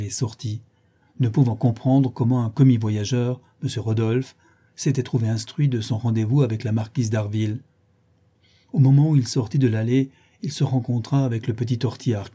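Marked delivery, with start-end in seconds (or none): none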